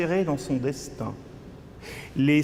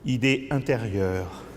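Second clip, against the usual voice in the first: usual speaking voice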